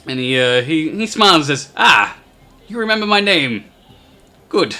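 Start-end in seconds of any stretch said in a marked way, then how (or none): 1.8-4.8 high, loud, pleased goblin voice. Acted. Goblin smiles at being remembered, pleased and high-voiced. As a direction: greet them higher and louder, as a goblin.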